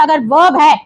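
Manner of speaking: ordinary delivery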